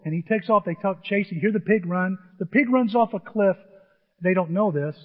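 ordinary delivery, nothing marked

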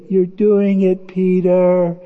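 drawn-out, wondering singsong; imitated awed kids. Two kids watching Peter imagine, awed drawn-out call. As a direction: stretch each phrase out in childlike wonder. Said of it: "And the two kids".

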